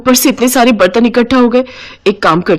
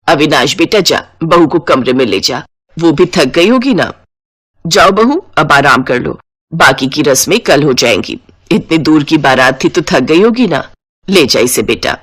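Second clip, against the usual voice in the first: lower, welcoming elder woman; lightly voiced motherly mother-in-law; 5 semitones lower than usual. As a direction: greet the new bride as her mother-in-law. Mother-in-law sends couple off.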